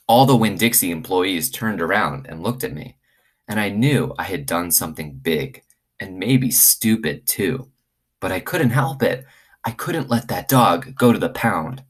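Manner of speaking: lively read-aloud for the class; story reading to children. Acted. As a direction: tell it like a bedtime story to kids. Reads chapter one aloud to class.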